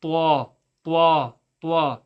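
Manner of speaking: "twa" with a W glide from rounded lips; wrong "ta", lips moving into "twa"; lips moved on TA. Shown as a demonstration. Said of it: "'Cause if you move your lips".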